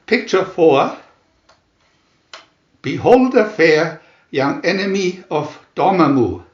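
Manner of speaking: ordinary delivery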